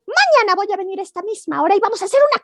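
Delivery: high, cheeky rabbit voice; storyteller voicing a bunny; little rabbit voice. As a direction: play the small bold rabbit. Rabbit challenging.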